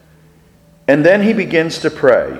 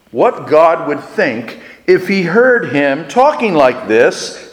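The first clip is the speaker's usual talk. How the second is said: usual speaking voice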